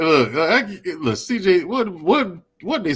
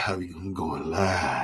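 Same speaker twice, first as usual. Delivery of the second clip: mock grunt; 8 semitones lower, 10 dB quieter, slower than usual. Shown as a demonstration. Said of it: "You sound like that, damn".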